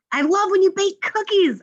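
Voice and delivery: high, lively exclamation; excited exclamation. Acted. Teacher reading an example exclamation to students, excited. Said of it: "I exclaimed".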